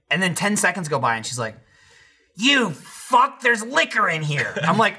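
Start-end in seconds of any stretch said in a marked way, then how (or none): 2.4-4.5 higher-pitched accusing outburst; mock outrage. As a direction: imitate an outraged mother. Retelling mother's reaction to liquor.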